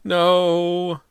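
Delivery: high, loud wailing no; mother wailing down the stairway. Acted. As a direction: let out a long wail from upstairs. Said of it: "Missus Fraser's wail".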